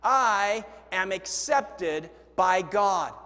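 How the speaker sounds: slow, loud unison declaration; slow group recitation. Shown as a demonstration. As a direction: read it out together slowly and firmly. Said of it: "Here we go, together".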